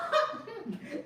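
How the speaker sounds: short laugh. Real, not acted. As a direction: chuckle it off. Laughing after the story.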